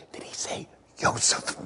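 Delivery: stunned whisper with a stifled laugh. Acted. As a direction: whisper it under your breath in disbelief. Stunned brothers whispering to each other.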